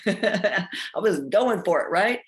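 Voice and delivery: laughing through the words. Real, not acted. Telling viewers about her gym plan, laughing. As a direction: chuckle while admitting the plan.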